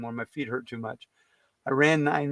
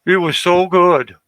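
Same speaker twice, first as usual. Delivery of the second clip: loud, slow it was so good; loud slow gushing about the food; savoring; 3 semitones higher, 10 dB louder, slower than usual. Real, not acted. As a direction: relish every word. Savoring lasagna.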